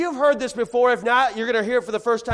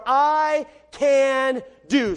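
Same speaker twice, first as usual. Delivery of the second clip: "I can do" very slowly, word by word; drawn-out emphasis; 2 semitones higher, 3 dB louder, much slower than usual. Shown as a demonstration. Preacher presents the verse's first three words, drawn-out emphasis. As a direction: stretch each word of "I can do".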